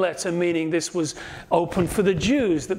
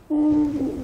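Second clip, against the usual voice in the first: wavering hum; 10 semitones higher than usual. Retold or imitated.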